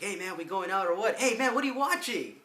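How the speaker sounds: high, loud, brash buddy voice; imitating Miguel. Miguel walking in and asking the friends, high and loud. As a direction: barge in like an impatient pal. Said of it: "he's all like".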